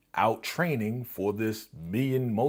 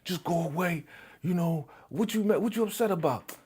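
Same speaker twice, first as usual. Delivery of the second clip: high, petulant get-lost voice; mock moody boxer; sulky brush-off; 8 semitones higher than usual. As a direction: wave him off from under the covers. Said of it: "He's like".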